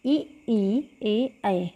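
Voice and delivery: ई ई ए ऐ each sounded separately and held; vowel demonstration. Shown as a demonstration. Teacher repeats front vowels with students, clearly sounded. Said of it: "एक बार और बोल के देखते हैं".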